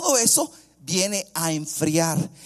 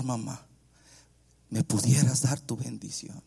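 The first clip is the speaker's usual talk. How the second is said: tender pleading; 3 semitones lower than usual. Retold or imitated. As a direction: plead gently, with pauses between phrases. Acts son tenderly asking for blessing.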